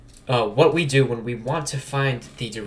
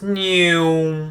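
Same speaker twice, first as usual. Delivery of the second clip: imitated Doppler whoosh; 6 semitones higher, 7 dB louder than usual. Shown as a demonstration. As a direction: mimic a passing truck, pitch falling. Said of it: "the beginning of that is, is gonna be really high pitched".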